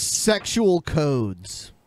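very slow, drawn-out announcement; slow theatrical drawl. Acted. Host drawing out a catchphrase to the chat, slow. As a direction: stretch each word like a movie trailer.

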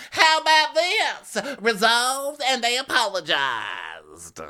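high, loud, slow and dismissive; mock haughty Erica. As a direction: brush it off grandly like a diva. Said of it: "And she goes".

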